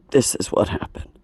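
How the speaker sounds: soft, voiceless whisper of a damaged voice; broken whisper. Real, not acted. A singer showing fans her ruined voice, whispering. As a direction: show them the voice is gone, only air. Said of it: "this is what I sound like now".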